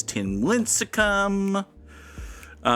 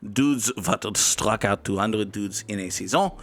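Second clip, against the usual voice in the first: put-on terrible French accent; 3 semitones lower than usual. Streamer reading the grid category in a bad French accent. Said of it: "[name]'s terrible French accent".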